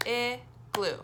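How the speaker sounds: teacher modelling syllable counting. Shown as a demonstration. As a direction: say "Igloo" again part by part. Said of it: "we count how many times our chin goes down".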